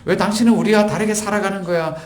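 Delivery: high-pitched mock indignant demand; imitating angry accusers; acted anger. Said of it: "그러면서 이렇게 화를 내는 거죠".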